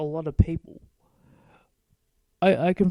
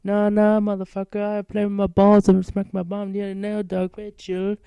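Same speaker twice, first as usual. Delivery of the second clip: loud mock gangsta swagger, pitched up; 4 semitones higher, 5 dB louder than usual. Kid waving off narrator's challenge, loud mock rapper voice. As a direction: imitate a wannabe rapper brushing off a fight, loud. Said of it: "He'd be like".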